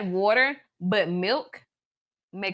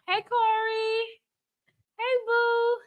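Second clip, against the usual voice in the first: tender sing-song hello, way up high; sweet greeting; 11 semitones higher, much slower than usual. Real, not acted. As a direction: coo hello like to a loved one. Greeting her baby.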